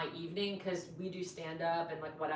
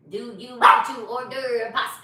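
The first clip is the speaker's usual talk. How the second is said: loud, slow, higher robot voice; imitated robot voice; 6 semitones higher, 14 dB louder than usual. Imitating her date ordering pasta, robot voice. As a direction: mimic a robot, loudly and slowly. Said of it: "he did a robot voice".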